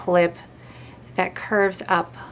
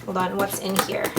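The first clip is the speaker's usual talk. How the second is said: usual speaking voice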